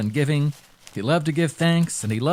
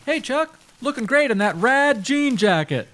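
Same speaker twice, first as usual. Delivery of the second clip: friendly shopkeeper; 9 semitones higher than usual. Acted. Shopkeeper calls out compliments.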